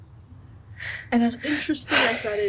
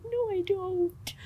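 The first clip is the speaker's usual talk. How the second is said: mock whine; 10 semitones higher, 6 dB quieter, much slower than usual. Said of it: "I'm like".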